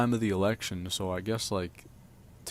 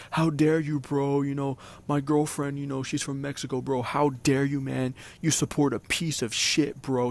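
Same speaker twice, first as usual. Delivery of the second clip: angry screaming; 5 semitones higher than usual. Retold or imitated. Friend screaming over a Discord call, furious. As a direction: shout him down in outrage. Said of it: "He was just, like, screaming at me".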